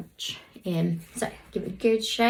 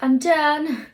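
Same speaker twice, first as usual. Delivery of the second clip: high, loud sassy outburst; pitched-up diva sass; sassy straighteners; 7 semitones higher, 9 dB louder than usual. Pretend. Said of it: "All sassy straighteners".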